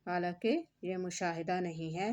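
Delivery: normal talking voice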